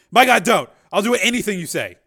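loud, high, desperate cry; panic-stricken pleading. Acted. Father begs gunman to stop shooting, panic-stricken. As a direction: plead frantically for your son. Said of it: "panic-stricken".

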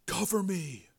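whispered. Acted. Joe asks his brother for cover amid gunfire, whispering. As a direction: say it under your breath. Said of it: "Joe whispered".

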